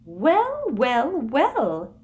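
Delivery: high, loud triumphant purr; triumphant Umbridge. A narrator's reading. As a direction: savour the victory. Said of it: "looking triumphant".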